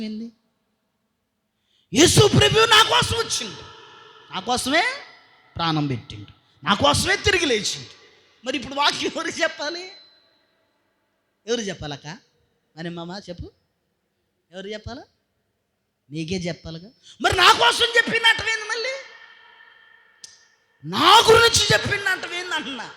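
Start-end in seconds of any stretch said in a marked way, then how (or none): 1.9-8.0 starts with a high loud cry, then settles; preaching climax. Acted. 17.2-22.9 high, loud scolding; rebuking shout. Acted. Preacher rebukes congregation.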